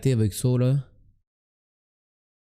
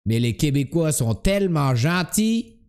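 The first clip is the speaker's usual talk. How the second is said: broaden the vowels like a Québécois; comic put-on Québécois accent. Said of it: "ton accent imité".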